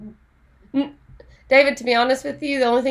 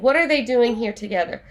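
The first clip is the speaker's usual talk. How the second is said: ordinary delivery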